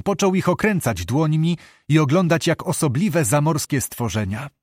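ordinary delivery

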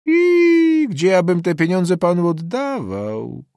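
high, loud, drawn-out peasant protest; indignant refusal. Acted. Peasant refusing to return the student's money, indignant. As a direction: wail it out high and slow in indignation.

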